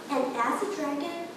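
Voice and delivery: usual speaking voice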